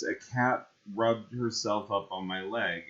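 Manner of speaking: usual speaking voice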